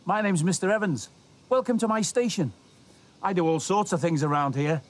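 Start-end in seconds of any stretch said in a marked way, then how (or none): 0.0-2.5 put-on bright, friendly welcome to young viewers; upbeat children's TV presenter; cheerful host. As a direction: greet the kids like a kindly stationmaster.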